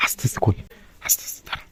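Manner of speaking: hushed whisper, almost no voice. Real, not acted. Sneaking toward his sleeping sister, whispering. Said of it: "আস্তে আস্তে কথা বলছি".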